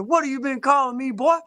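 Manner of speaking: high, mock-challenging call-out; high-pitched teasing challenge to a friend; mock confrontation. Confronting Smoke over the nickname.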